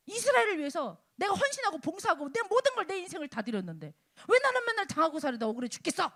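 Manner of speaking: high, loud, rushed protest of injustice; acted aggrieved lament. Preacher voicing David's complaint about always suffering, aggrieved lament.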